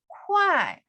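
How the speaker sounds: kuai starting high, dropping steeply; falling tone. Shown as a demonstration. Teacher showing the downward tone, kuai falling.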